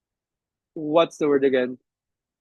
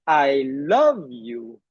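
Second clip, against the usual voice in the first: very slow, "love" stretched with a pitch swell; "love" drawn out as the key word; stress on LOVE; 3 semitones lower, much slower than usual. Shown as a demonstration. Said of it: "It could be".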